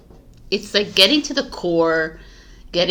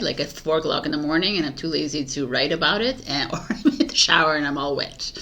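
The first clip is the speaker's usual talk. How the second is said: ordinary talk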